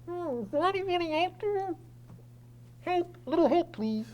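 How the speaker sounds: high, muffled plea from inside a coffin. Acted. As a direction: call out for help through a closed lid. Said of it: "You hear a muffled voice inside saying".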